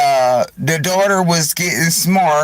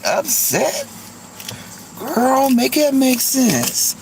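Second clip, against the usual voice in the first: high, incredulous talking-to; sassy mock scolding; 6 semitones higher than usual. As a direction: scold her like a girlfriend calling her out. Mocking the complaining woman on live.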